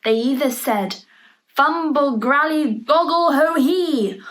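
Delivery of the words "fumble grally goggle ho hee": sing-song magic chant; magic words. Acted. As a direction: chant it like a playful spell. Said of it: "said some magic words".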